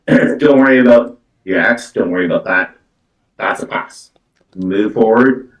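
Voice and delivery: ordinary talk, nothing marked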